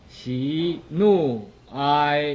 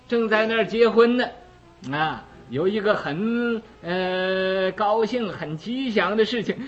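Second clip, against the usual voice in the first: usual speaking voice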